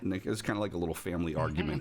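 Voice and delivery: usual speaking voice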